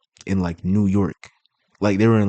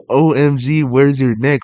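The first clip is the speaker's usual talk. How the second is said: louder, higher mock-sarcastic quote; raised-voice imitation of a sarcastic remark; imitated sarcasm; 5 semitones higher, 6 dB louder than usual. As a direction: mimic her sarcastic jab, pitched up. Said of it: "she said it like she was being sarcastic".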